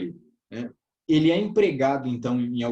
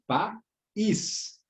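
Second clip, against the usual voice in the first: "país" in two separate beats, pa / ís, pitched up; higher, measured pa-ÍS for "país"; split into syllables; 6 semitones higher than usual. Shown as a demonstration. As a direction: say "país" syllable by syllable, pausing between pa and ís. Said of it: "é dividida em duas sílabas".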